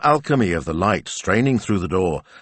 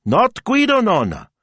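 put-on very high, loud little-girl voice; high, indignant child correction; correcting child; 5 dB louder than usual. A narrator's reading. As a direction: correct grandma insistently, pitched way up.

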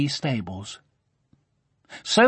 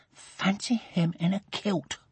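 softer, private scornful musing; scornful thought; 6 semitones higher, 7 dB quieter than usual. A narrator's reading. Sophie privately mocking Duncan, softer and scornful. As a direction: mutter it to yourself, quietly mocking. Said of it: "thought Sophie".

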